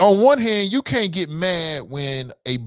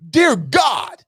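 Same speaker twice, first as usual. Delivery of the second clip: exasperated cry; 8 semitones higher than usual. Real, not acted. Exasperated at Gowdy's TV show.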